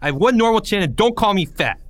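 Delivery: put-on a kid losing his temper, loud and sharp; snapped. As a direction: bite back angrily, voice up. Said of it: "Porge snapped".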